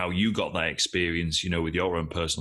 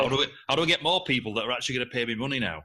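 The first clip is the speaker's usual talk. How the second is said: fast, higher, lightly voiced worried coach; a hint of a panicked new business owner; mock anxious thought; 5 semitones higher, much faster than usual. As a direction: rattle it off like a fretting beginner. Voicing a new coach's worry.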